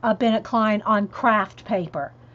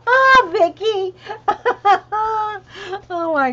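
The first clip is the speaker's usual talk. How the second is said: laughing; 10 semitones higher, 6 dB louder than usual. Real, not acted. Laughing at a comment.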